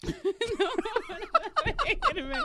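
high giggling protest; laughing. Real, not acted. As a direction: laugh through the words. Laughing fit.

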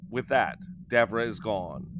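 usual speaking voice

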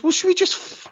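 high, sweet mock Sarah Jane. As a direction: chirp it like a bored companion. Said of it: "she just goes".

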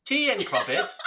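high, soft mock English accent; dainty, high-pitched English tea-time accent; English accent attempt. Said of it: "English accent".

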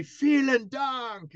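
slow drawn-out German Vielen Dank called out; German thank-you. Retold or imitated. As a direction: stretch out "Vielen Dank" like a stage shout in German. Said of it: "you can say".

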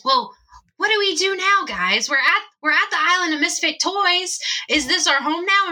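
high, uncertain toy character voice; Hermie. Acted. Hermie asks the gathered group what now, high-voiced. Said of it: "And Hermie goes".

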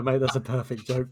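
ordinary talk, nothing marked

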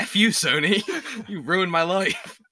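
high, loud mock rant at Sony; hammed-up fury with a laugh; mock outrage.

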